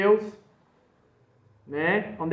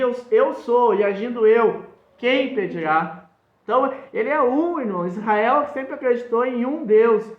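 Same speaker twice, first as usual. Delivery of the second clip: raised, louder pulpit voice; fiery preaching; 5 semitones higher, 4 dB louder than usual. Acted. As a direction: preach it hard to the congregation. Preaching against the Trinity.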